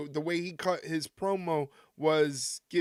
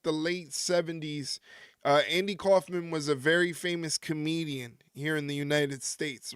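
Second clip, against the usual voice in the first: usual speaking voice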